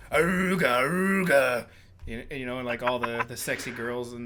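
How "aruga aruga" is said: shouted aruga horn; loud horn noise. Retold or imitated. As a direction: blare it like a car horn. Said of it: "You gotta go".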